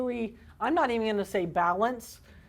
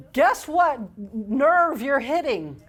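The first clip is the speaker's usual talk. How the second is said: ordinary talk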